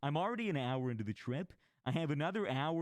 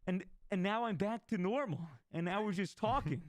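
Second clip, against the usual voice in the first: relieved stoned friend; 6 semitones higher than usual. Retold or imitated. Friend back to normal.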